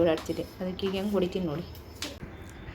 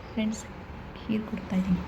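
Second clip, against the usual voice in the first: hushed secret aside; whispered aside; 5 dB quieter than usual. Real, not acted. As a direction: whisper to the viewers so he won't hear. (ಪಿಸುಮಾತಿನಲ್ಲಿ ಗುಟ್ಟು)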